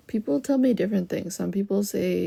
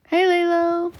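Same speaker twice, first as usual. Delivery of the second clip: excited greeting; 10 semitones higher, 6 dB louder than usual. Real, not acted. Greets viewer on live.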